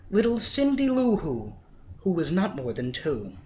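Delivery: ordinary delivery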